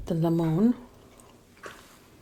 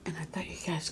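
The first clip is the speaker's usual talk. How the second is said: whispering; 2 semitones lower, 11 dB quieter, faster than usual. Real, not acted. Whispered aside to viewers.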